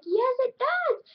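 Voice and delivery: very high bickering retort; mock insistence. Retelling the group's comeback to him, squealed mock insistence. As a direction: fire back in a hammed-up squeal. Retold childish back-and-forth squabble.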